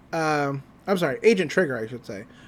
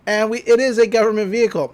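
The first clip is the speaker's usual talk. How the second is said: ordinary delivery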